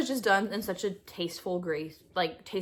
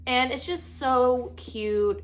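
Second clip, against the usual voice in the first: gushing; 4 semitones higher, much slower than usual. Real, not acted. Gushing over a romance book.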